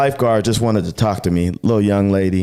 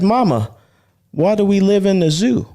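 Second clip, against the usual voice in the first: put-on higher child's voice asking mama; a little camel's plaintive question, pitched up; 10 semitones higher than usual. Camel child asks his mother, higher-pitched plaintive question.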